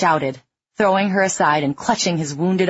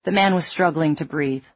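ordinary delivery

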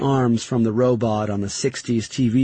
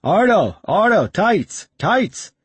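urgent yell to a crewman; 9 semitones higher, 4 dB louder, slower than usual. Acted. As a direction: shout it out high and loud. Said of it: "He yelled out to the other man".

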